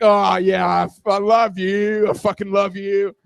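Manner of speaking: hammed-up dirty talk, stretched and loud; mock sexy. Man told to talk dirty, hammed-up sexy. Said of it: "like, a sexy way".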